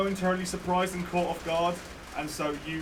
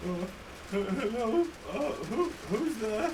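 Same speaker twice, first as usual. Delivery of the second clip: lightly voiced old man, quavering, higher; a hint of a frail old man calling out; 5 semitones higher, slower than usual. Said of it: "you hear a quavering voice going".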